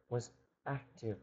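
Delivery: usual speaking voice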